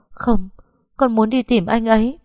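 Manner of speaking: desperate pleading refusal; distraught refusal. Acted.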